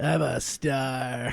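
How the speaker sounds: low, soft, very slow star voice; movie star voice. Pretend. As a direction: purr it like a vain star. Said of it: "This is movie star band voice".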